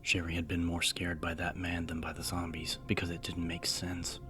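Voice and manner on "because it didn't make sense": ordinary delivery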